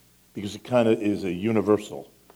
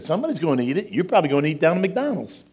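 sarcastic retort; 8 semitones higher, 4 dB louder, faster than usual. Retold or imitated. Pastor retorts to complainer.

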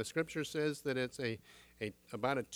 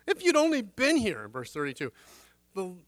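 higher, loud pleading cry from a sister; grieving Mary; 5 semitones higher, 6 dB louder than usual. Acted. Mary wailing to Jesus, higher, pleading.

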